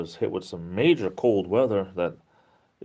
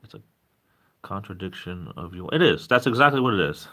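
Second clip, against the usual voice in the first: ordinary talk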